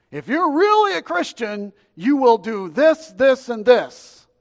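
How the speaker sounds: high, booming ultimatum; imitated hard-line preaching, loud and emphatic; mock demanding preacher. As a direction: lay down demands at full volume. Acting out demanding radio preachers.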